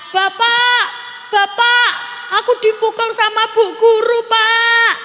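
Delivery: put-on high, childish complaint to father; whining child. Child tells father about the teacher, whining high. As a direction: play a child running home to complain. Crying child voiced in story.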